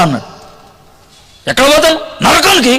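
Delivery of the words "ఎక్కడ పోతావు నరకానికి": high, sharp angry answer; mock angry retort to a friend. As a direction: snap back in anger, pitched up. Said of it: "అని అన్నా కోపంతో".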